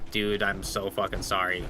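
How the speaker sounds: low, soft, halting condolence; breaking bad news. Telling the roommate about the squirrel, somber and apologetic. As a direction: retell it gently, pausing before the bad news. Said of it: "break the bad news to him".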